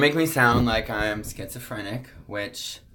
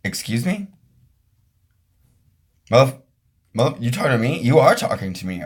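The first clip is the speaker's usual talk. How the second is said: mock shocked disbelief; faster than usual. Shocked at being addressed.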